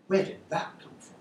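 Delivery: plain reading voice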